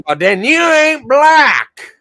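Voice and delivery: loud, slow mock retort; chat quote. Streamer reading a chat comment aloud, loud slow mock retort. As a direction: bark it slow and loud like a know-it-all. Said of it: "and everyone going".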